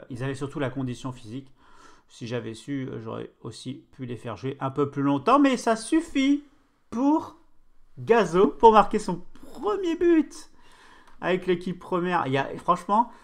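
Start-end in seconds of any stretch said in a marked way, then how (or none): 5.4-12.3 commentateur excité. Real, not acted. Commente le premier but de Gazo.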